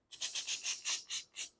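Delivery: a run of whispered shh shh sounds; shushing. Acted. God stopping Samuel from anointing the wrong son, shushing. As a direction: cut him off with quick hushing.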